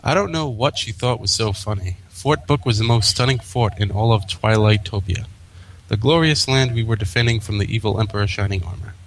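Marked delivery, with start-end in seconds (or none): none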